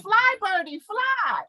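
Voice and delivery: high, urging mother bird; playful high-pitched urging. Acted. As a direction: send it off in a lifted, sing-out voice. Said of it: "that mother bird that kind of pushes".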